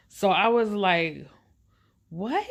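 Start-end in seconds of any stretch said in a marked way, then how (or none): 2.1-2.4 stunned what. Retold or imitated. Hearing his offer to pay rent.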